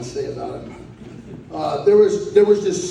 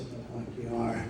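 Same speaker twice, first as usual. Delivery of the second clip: muttered retort; 6 semitones lower, 16 dB quieter than usual. Retold or imitated. John grumbling back at the nurse, muttered under breath. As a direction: mimic a weak sick man grumbling under his breath.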